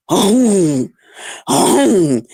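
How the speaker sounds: low bear-like groan, mocking; mock her with a deep, lumbering moan; deep man voice. Mocking how a big woman sounds, deep bear-like groan. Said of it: "with that deep man goddamn voice".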